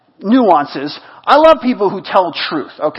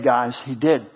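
normal talking voice

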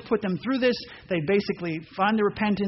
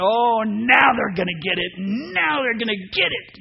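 mock-vengeful shout; gleeful revenge; 2 semitones higher, 4 dB louder than usual. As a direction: relish the payback coming. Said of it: "I'm going".

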